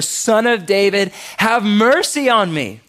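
high, loud, drawn-out plea; desperate shout. Acted. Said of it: "he shouted all the more".

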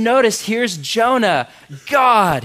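usual speaking voice

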